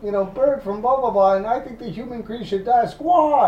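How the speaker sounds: very high, screechy bird squawk voice; high mock bird voice ranting; squawking bird person. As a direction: squawk it like a deranged parrot. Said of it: "people that are supposed to be birds".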